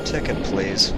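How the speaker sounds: lightly voiced indifferent conductor; a hint of a bored ticket man. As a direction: ask without interest.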